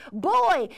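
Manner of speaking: put-on Boy said alone, loud and leaning in; stress on boy. Shown as a demonstration. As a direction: punch the word Boy. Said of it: "emphasis on boy".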